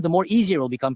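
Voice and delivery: ordinary delivery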